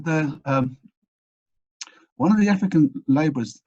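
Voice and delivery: ordinary delivery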